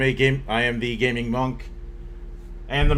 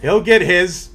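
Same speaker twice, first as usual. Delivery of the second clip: loud mock threat; playful loud threat; 6 semitones higher, 9 dB louder, slower than usual. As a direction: bellow it as a joke threat.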